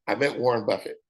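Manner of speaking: usual speaking voice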